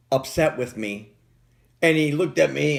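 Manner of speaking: usual speaking voice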